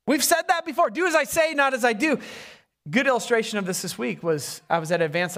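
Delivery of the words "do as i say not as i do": quicker, higher mock retort; high-pitched retort. Preacher mimicking what everyone has said, high retort. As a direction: toss the saying out high, in one go.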